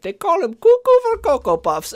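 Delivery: high, loud, cartoonish villain imitation; a mock trailer narrator in a cartoonish accent; Doofenshmirtz voice. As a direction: ham it up like a cartoon mad scientist. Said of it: "I don't know why I sound like Doofenshmirtz".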